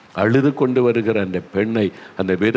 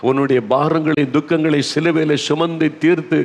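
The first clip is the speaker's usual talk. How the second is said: higher, impassioned altar-call preaching; fervent preaching; 4 semitones higher than usual. Acted. Preacher appealing to grieving listeners, impassioned and fervent. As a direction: plead with the hurting listeners from the pulpit.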